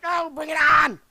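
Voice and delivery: brash shout; shouted dare. Retold or imitated. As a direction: yell the challenge. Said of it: "So I was like".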